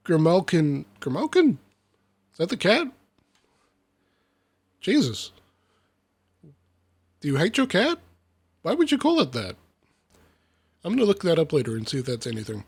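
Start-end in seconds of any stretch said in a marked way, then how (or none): 1.0-1.6 higher-pitched, puzzled repeat; incredulous query. Real, not acted. Puzzled by the odd name. 2.4-9.6 baffled questioning. Real, not acted. Baffled by cat's name.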